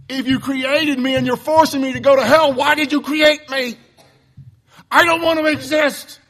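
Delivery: pleading in real frustration; getting upset. Real, not acted. Preacher protesting Calvinism to his class, upset. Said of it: "you see I'm getting upset".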